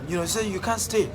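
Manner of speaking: normal talking voice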